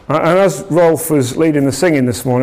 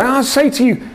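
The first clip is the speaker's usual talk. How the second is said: higher, ringing prophetic declaration; Jesus proclaiming to the crowd, prophetic and raised; prophesying; 7 semitones higher than usual. Retold or imitated. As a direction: announce it like a prophet. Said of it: "then he starts to prophesy".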